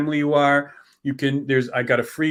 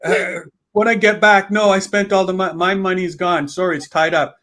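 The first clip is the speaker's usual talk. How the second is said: higher, louder playful dodging; mock excuses; 6 semitones higher, 4 dB louder than usual. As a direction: make hammed-up excuses to get out of paying. Acting beneficiary dodging a refund request.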